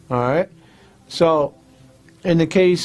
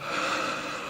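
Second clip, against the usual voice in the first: demo sigh; 13 dB quieter than usual. Shown as a demonstration. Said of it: "deep breath".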